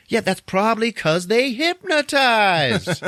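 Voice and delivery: high, loud, drawn-out comic yell; exasperated shout. Retold or imitated. Rod to Chris on the phone, exasperated yell. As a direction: yell the obvious at a clueless friend. Rod's acted punchline on phone.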